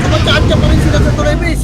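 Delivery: loud, steady call to teammates; loud callout. Real, not acted. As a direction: call it out so all hear. Shotcaller calling team to sync. (malakas na tawag)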